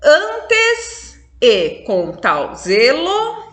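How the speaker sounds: slow demonstration reading; slow scansion. Shown as a demonstration. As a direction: draw out the words so the students hear the syllables join. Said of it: "ele já emenda com E".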